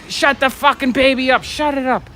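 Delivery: high-pitched mock yell; retold yelling. Robber yelling at parents about their crying baby, mock yell. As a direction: imitate a man yelling, voice jumping up without full volume. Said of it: "He starts yelling".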